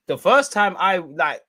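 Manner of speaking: ordinary delivery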